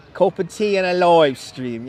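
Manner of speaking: hammed-up British vowels; mock Cockney accent. Streamer joking about the live stream in a mock Cockney accent. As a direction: put on a broad comic Cockney. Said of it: "Sounds a bit cockney".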